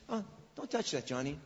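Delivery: soft, half-hearted warning; limp, mild warning. Shown as a demonstration. Parent mildly warning Johnny away from the stove, soft and limp. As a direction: say it softly, like it barely matters. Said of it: "Why don't you say".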